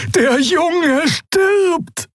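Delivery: high desperate scream. Acted. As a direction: cry out in despair. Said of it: "schrie Xea".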